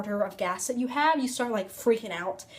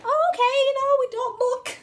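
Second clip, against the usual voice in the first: much higher, thin forced-casual voice; squeaky nervous mock calm; 12 semitones higher, 4 dB louder than usual. To her friend while passing creepy churches, a squeaky nervous mock calm. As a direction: pretend everything's fine in a tiny high voice.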